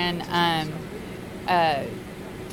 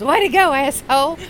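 high, loud sarcastic jab; mocking captain; 6 semitones higher, 5 dB louder than usual. Captain teasing the engineer about drinks, high and loud. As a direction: rib him loudly and sarcastically. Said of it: "the captain turns to him and says".

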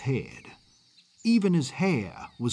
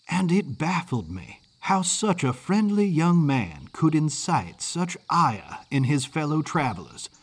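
ordinary delivery

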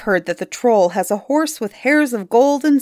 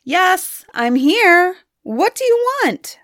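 high, loud shout through cupped hands; boy shouting back to the troll; calling back; 11 semitones higher, 4 dB louder than usual. A narrator's reading. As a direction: shout back across the bay. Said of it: "made a trumpet of his hands and called back".

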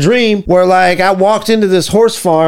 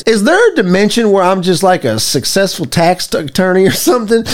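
ordinary talk, nothing marked

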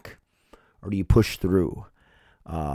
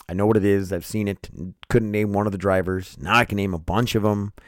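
ordinary delivery

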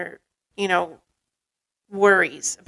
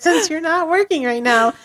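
higher mock quote of a partner; incredulous mimicking; 8 semitones higher, 4 dB louder than usual. Retelling partner's awkward preface on lunch break, incredulous mimicking. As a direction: throw the words back in disbelief.

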